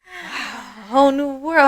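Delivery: high, wondering mock exclamation; blissful amazement. Clients coming out after a massage, imitated in amazement.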